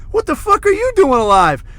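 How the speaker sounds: very high, loud mock disbelief; astonished outburst. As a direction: cry it out in acted amazement. Repeats his shocked question.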